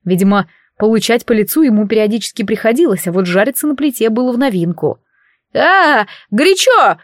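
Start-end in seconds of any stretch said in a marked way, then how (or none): 5.5-7.0 high, loud cry of pain; yelling in pain. A narrator's reading. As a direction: shriek as the stove burns. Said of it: "заорал Марус". (крик боли)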